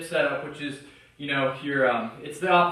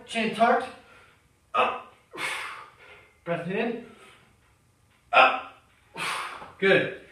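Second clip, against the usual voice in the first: live rep-by-rep coaching; 3 semitones higher than usual. Acted. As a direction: push the lifter through each rep like a trainer. Cues reps while doing dips.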